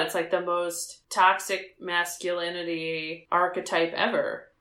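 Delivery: ordinary talk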